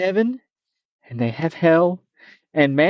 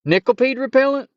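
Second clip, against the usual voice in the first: put-on young man voice; 9 semitones higher than usual. Bink asks hopefully.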